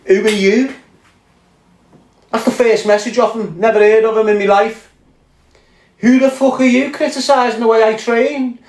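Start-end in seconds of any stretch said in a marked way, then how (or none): 0.1-0.7 incredulous retort. Retold or imitated. Retorting to an unknown critic. 6.0-8.6 indignant retort. Retold or imitated. Angry reaction to criticism.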